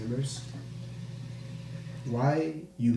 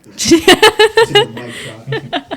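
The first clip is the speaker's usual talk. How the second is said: high, loud and slow through laughter; laughing; 14 dB louder, much slower than usual. Real, not acted. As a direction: laugh the words out. Laughing remark about the answer.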